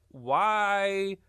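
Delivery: usual speaking voice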